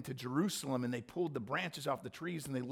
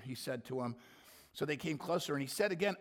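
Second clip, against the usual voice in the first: normal talking voice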